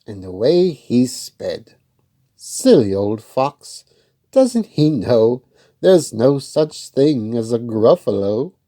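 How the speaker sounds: put-on slow, loud read-aloud voice for kids; story narration. A narrator's reading. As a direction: tell it slowly and clearly to children.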